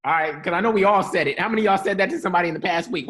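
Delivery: ordinary delivery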